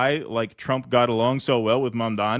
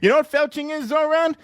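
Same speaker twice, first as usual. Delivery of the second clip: higher, bragging Trump-style rambling; Donald Trump voice; 8 semitones higher than usual. Acted. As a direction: play Trump chatting up a guest, pleased with himself. Host slips into Trump voice.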